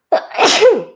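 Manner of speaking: a sudden loud sneeze; sneezing. Real, not acted. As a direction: let out a real sneeze. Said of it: "sorry, I'm gonna sneeze in a minute".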